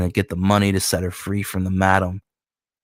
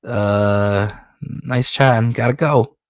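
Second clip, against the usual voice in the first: nervous escape; 3 semitones higher than usual. Acted. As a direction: back out of the room awkwardly. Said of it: "Goose is like".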